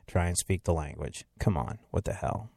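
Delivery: low, soft grumble; under-the-breath exasperation; grumbled aside. Acted. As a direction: drop to a muttered mock complaint. Grumbled end of irritated local.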